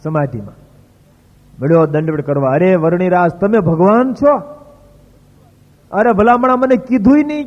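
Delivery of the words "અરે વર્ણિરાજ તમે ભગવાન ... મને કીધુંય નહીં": much higher and louder, overjoyed; rapturous devotee's cry; ecstatic outcry. Retold or imitated. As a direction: cry out, beside yourself with joy at seeing God. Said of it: "Mohandas તો ગાંડો બીનો".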